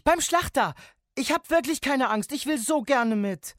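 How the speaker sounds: put-on high, loud child's plea; pleading child. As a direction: beg loudly like a kid desperate to come. Child pleads to come along.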